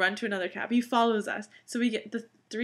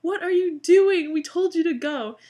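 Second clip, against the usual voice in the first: much higher, much louder exasperated shout; angry yell; 8 semitones higher, 9 dB louder than usual. Retold or imitated. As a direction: yell at the driver who didn't leave. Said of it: "we start yelling at the cab driver".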